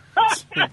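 high braying laugh as the laughing donkey. Acted. The laughing donkey from the joke, a high braying laugh. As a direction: bray out a high donkey cackle. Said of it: "sale el burro cagándose de la risa".

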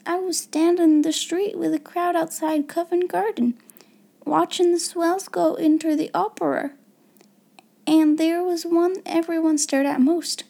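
put-on young maid marvelling at a memory; awed. A narrator's reading. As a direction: recount it in hushed wonder. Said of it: "she said in an awed voice".

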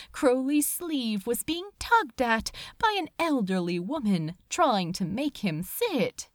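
plain reading voice

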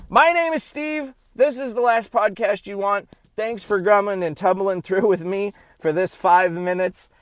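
high, loud show-host sign-off; booming outro host voice; podcast outro announcer. Acted. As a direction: wrap up the show like an announcer to the audience.

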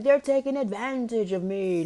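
high mock-tearful wail; hammed-up retold sobbing complaint; bawling. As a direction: whine it high as if crying. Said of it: "I am just bawling, crying".

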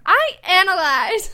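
slow, loud grand declaration; dramatic. Pretend. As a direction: proclaim it like a motto. Repeats motto dramatically.

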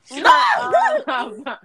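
high, loud cry of stop; loud protest. Real, not acted. Protesting loudly at friends teasing her dream. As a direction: shut down the teasing with a yelp.

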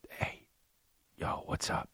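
imitating a kid whispering at nap time; acted whisper. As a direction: whisper it secretly to a classmate.

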